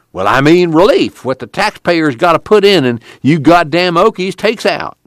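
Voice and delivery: high-pitched, scornful rural lawman; scornful deputy. Acted.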